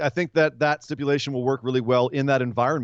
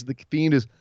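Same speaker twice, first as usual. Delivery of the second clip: ordinary talk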